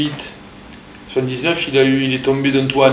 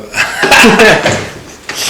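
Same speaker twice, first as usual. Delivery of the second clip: short breathy laugh, a bit higher and louder; nervous little laugh; 8 semitones higher, 6 dB louder than usual. Real, not acted. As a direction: laugh it off before starting.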